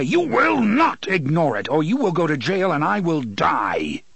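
a mild narrated roar. A narrator's reading. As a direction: boom the warning out. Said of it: "the voice roared".

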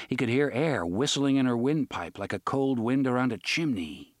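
plain reading voice

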